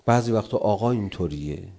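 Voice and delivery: ordinary delivery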